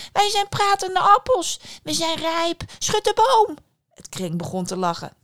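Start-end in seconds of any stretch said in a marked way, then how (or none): 0.1-3.6 high, loud, shrieky little callers; little apple voices. Acted. Apples suddenly call to the dazed daughter, high little voices. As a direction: squeal for help as tiny apples. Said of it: "riepen de appels".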